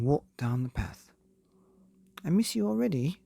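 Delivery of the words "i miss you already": high, louder longing call. A narrator's reading. Wife calls to departing husband, high and loud. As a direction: call after him down the path. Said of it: "called Missus Meek".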